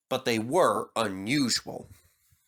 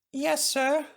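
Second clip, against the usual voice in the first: much higher, hesitant yes; doubtful; 13 semitones higher than usual. A narrator's reading. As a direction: agree without believing it. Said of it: "said Peter Sam doubtfully".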